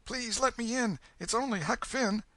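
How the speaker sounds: scared low tone. Acted. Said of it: "Huck's scared voice answered in a low tone".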